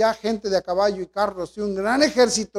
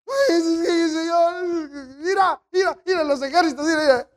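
panicked servant; 6 semitones higher than usual. Acted. Servant pointing at the armies, panicked.

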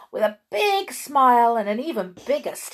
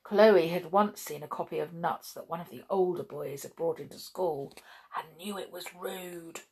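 plain reading voice

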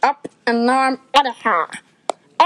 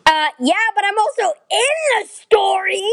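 ordinary talk, nothing marked

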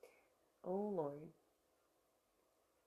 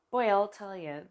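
ordinary talk, nothing marked

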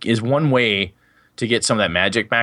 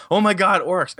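mock alarmed players; 9 semitones higher than usual. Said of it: "the players, of course, saw".

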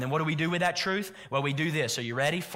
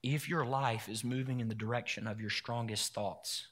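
quiet reflective preaching; 3 semitones lower, 4 dB quieter than usual. Acted. Pastor inviting the congregation to imagine peace, softly. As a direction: draw them in gently, half-hushed.

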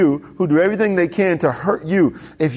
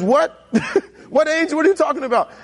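high, laughing what-angel disbelief; baffled surprise; 9 semitones higher than usual. Retold or imitated. Preacher voicing Balaam baffled by the angel, high incredulous. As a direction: play it incredulous, pitched way up.

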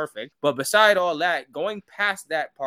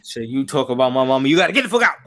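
building to a loud kick-out order; mock angry outburst; 8 dB louder, faster than usual. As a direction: imitate an offended son, louder as it goes. Acts Tenzin angry about his mama.

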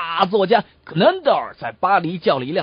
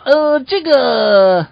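loud, slow drawn-out filler; hesitant coachman; 3 semitones higher, 10 dB louder, much slower than usual. Acted. Coachman asked how he knew the writer, loud hesitation. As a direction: stall loudly while hunting for words. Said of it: "赶车的人说道".

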